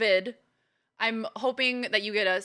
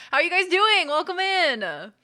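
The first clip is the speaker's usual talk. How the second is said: bright greeting; 6 semitones higher, 6 dB louder than usual. Real, not acted.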